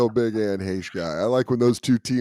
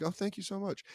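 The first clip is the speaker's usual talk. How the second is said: grateful customer; 10 semitones higher, 12 dB quieter than usual. Retold or imitated. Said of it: "you're like".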